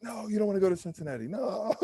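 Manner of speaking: high, fast mock-dismissive no; agents saying no. Agents warning him off Cincinnati, high fast dismissive no. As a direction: brush the idea off, imitating the agents. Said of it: "they're like".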